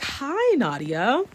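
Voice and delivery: high swooping, exaggerated hello; mock-sweet greeting. Girl grabbing her arm tight, mock-sweet greeting. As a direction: imitate a falsely friendly high-pitched greeting. Imitates a girl's overfriendly greeting.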